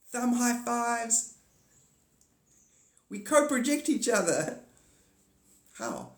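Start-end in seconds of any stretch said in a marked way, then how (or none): 0.1-4.6 high playful voice. Acted.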